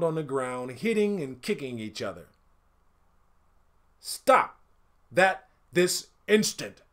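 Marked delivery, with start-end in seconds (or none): 4.0-6.8 high, loud, slow order; commanding village chief; stern command. A narrator's reading. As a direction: halt the fight with authority. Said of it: "said the chief".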